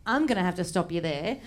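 imitated boyfriend. Retelling boyfriend's grave correction.

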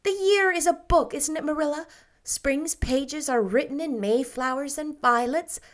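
put-on bright, breathless girlish rapture; Anne's voice. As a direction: gush like a dreamy, happy girl. Anne voiced, girlish, higher.

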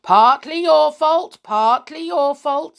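loud, high, chanting blame; accusing disembodied voice. A narrator's reading. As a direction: ring out the accusation. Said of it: "said the voice again".

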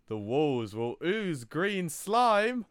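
slow, high, loud spooky wail; spooky ghost voice. Pretend. Telling coworker the ghost's third sign, slow spooky wail. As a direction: draw it out like a ghost story.

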